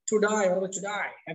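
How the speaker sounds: slow British today, টু ডাই ending in "eye"; British pronunciation. Shown as a demonstration. Teacher showing how British speakers say it, টু ডাই as "to die". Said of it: "এটা যে আপনার British".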